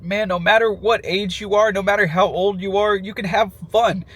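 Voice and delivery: ordinary delivery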